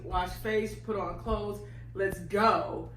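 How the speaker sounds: ordinary delivery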